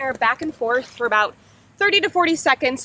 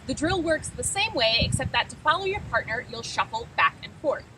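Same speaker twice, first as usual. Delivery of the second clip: normal talking voice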